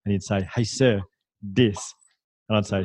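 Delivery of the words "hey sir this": slow, drawn out, with this said as dis; a boy stretching out this as dis, no th; kid mispronouncing this. Retold or imitated. Kid showing his teacher something, this said as dis. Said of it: "he couldn't pronounce the T H".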